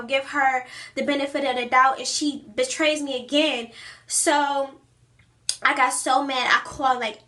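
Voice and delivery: usual speaking voice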